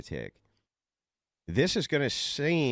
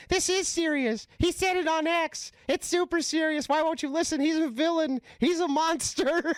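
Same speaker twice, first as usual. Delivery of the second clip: very high squealing mock panic; hysterical caller. Caller begging the operator to listen, very high mock hysteria. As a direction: plead in a far higher, hammed-up hysterical voice.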